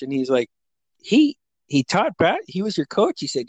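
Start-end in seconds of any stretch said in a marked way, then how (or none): 1.0-3.2 put-on incredulous kid. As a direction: play a kid who can't believe it, pitched up. Retelling nephew's disbelieving question.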